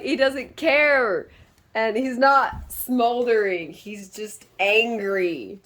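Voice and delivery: slow, measured description; slow and deliberate. Real, not acted. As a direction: lay it out slowly. Describing the character slowly.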